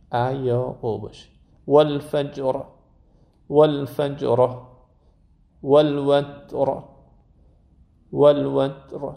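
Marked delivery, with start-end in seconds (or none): 1.7-9.1 والوتر with the ت released with a clear puff of air; والفجر and والوتر, final letter given its breath; breathy T in والوتر. Shown as a demonstration. Teacher showing students how to release the T.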